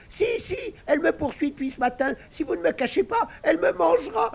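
high, loud and fast squeaky pig voice; panicky piglet. Acted. Little pig insists to the sisters he will be eaten, fast high squeal. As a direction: rush the words out in a frightened squeal.

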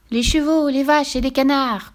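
put-on high, slow child voice; child correcting. A narrator's reading. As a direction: correct your parent like a little kid. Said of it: "corrigeait son fils". (voix d'enfant qui corrige)

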